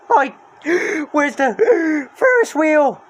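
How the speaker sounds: gasping, high and loud; out of breath from running; yelled while running. Acted. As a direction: pant it out mid-run. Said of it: "Shaggy yelled as he ran".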